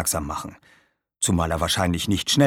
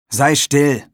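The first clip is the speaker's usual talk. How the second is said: higher, louder curt command; angry order; 6 semitones higher, 7 dB louder than usual. Acted. Aaron tells his friend to be silent, angry order. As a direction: shut the argument down.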